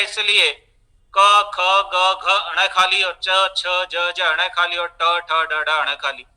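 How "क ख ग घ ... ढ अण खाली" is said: letters chanted on a level tone, dropping off; rote alphabet recitation. Retold or imitated. Teacher imitating untaught students' alphabet drill, rote recitation. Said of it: "वो नहीं बताया जाता है".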